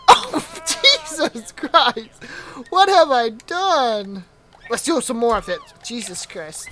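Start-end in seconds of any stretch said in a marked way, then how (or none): 0.1-4.2 slow, high-pitched, laughing disbelief; laughing dismay. Real, not acted. Player seeing what he has done, slow laughing dismay. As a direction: draw it out through a real laugh.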